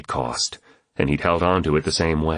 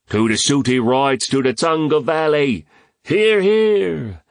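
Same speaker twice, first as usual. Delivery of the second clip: high, declaimed mock-Irish toast; hammed-up Irish brogue rising to a cry; mock Irish accent; 9 semitones higher, 4 dB louder than usual. A narrator's reading. Toastmaster salutes the valley, in mock Irish accent. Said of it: "continued with a dreadful mock Irish accent".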